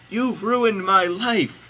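high, slow loud wail; Mary screaming. A narrator's reading. Host reading Mary as Jesus runs away, screaming. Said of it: "screamed Mary".

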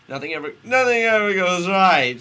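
high, very loud melodramatic outburst; hammed-up furious wail; crying out. A narrator's reading. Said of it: "she cried out".

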